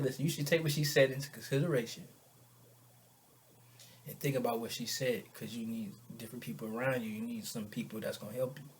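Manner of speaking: low, soft counselling; quiet advice. Retold or imitated. Friend advising a man to heed her, quiet advice. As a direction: drop down low and advise him gently.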